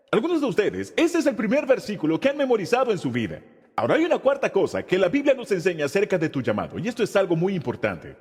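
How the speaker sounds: ordinary talk, nothing marked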